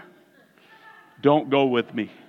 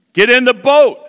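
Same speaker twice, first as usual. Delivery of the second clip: louder, firm order with weight on boat; firm command; 8 semitones higher, 7 dB louder than usual. Retold or imitated. God answering the preacher's questions, firm loud command. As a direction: lay down the law, no more asking. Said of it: "when God starts getting firm with you".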